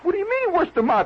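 high-pitched startled comeback; baffled disbelief. Acted. Guest questions the warning about the tail, baffled. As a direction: throw it back in disbelief.

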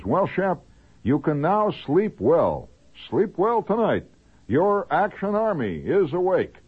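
high, slow, swelling proclamation; grand declamation. Acted. Army man assuring host the nation is guarded, high pompous declamation. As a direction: announce it with mock patriotic pomp. Declaims soldier's letter sign-off.

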